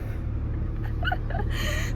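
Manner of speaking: helpless high-pitched laughter; high squealing laugh. Real, not acted. Laughing after the compliment.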